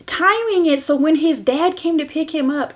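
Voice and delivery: ordinary talk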